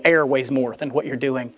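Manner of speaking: normal talking voice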